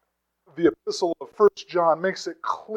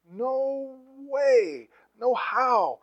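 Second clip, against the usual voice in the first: a high, ringing mock no; half-playful emphatic refusal, pitched high; super emphatic; 5 semitones higher, 3 dB quieter, much slower than usual. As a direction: fling the answer up high in mock disbelief. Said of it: "the super emphatic answer".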